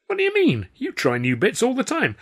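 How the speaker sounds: high, loud, indignant objection; protesting. A narrator's reading. Performer answering the star's accusation, protesting.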